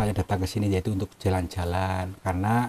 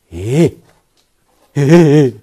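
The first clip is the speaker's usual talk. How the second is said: real loud scared yell, high; a jolted cry of alarm; startled shout; 6 semitones higher, 16 dB louder than usual. Real, not acted. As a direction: shout out in sudden fright. Sudden fright in the cave.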